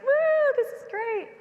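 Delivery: very high, loud whoop of delight; kid cheering. Retold or imitated. Kids running to the river with pans, high whooping joy. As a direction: cheer like a thrilled child heading to the river.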